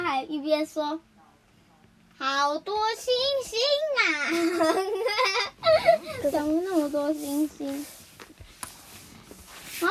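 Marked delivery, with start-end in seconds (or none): 2.2-7.9 see stars after a bump; dazed mouse. Acted. Dazed mouse after hitting wall.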